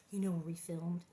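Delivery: conspiratorial whisper. Real, not acted. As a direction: whisper it like a secret.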